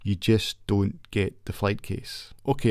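ordinary talk, nothing marked

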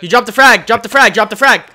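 high, loud urgent callout; urgent shout. Real, not acted. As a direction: yell the warning fast.

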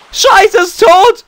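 high, loud, slow horrified cry; shocked outcry. Acted. Finding Manafi collapsed, slow horrified shout. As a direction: cry it out in mock horror.

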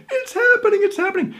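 very high, excited squeal; thrilled exclamation. Retold or imitated. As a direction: retell the thrill, voice shooting way up. Retelling his thrilled inner reaction.

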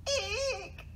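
high-pitched squeak of fright; tiny startled mouse cry. A narrator's reading. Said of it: "Mouse squeaked".

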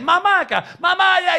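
put-on whiny kid calling out to his mother; imitating a demanding son; child calling mom.

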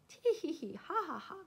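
high tittering tee-hee into ha-ha; acted giggle. Monkeys hiding behind bushes, mischievous giggling. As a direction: giggle in a put-on, storybook way.

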